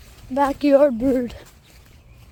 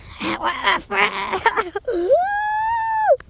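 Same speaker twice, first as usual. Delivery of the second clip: shrill burst of laughter; high shrieking laugh; 8 semitones higher than usual. Real, not acted. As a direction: squeal with laughter. Laugh at the bird.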